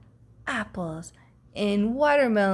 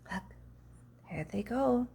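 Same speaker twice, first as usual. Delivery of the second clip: a soft aside to the listener; hushed there they go; 10 dB quieter, faster than usual. A narrator's reading. Watching the monkeys leave.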